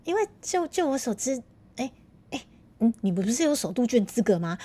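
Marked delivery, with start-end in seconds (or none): none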